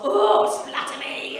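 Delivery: loud, high, drawn-out mock ecstasy; mock horny outburst. Woman at spoilt milk, mock-ecstatic loud slow cry. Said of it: "we'd get all fucking horny".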